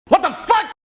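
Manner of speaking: a sudden high, loud yell of disbelief; startled yell. Real, not acted. Startled by the noise, yelling loud and high. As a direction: blurt it out in real shock.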